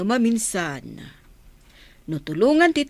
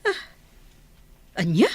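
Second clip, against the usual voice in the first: normal talking voice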